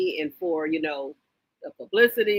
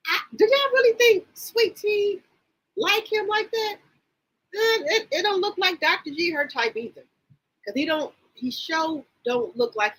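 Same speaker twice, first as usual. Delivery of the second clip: incredulous; 14 semitones higher than usual. Real, not acted. Incredulous question to viewers.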